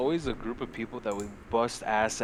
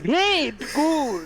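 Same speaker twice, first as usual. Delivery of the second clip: high, loud cheery praise from the game; 6 dB louder than usual. Retold or imitated. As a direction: chirp the game's praise. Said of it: "the game's like".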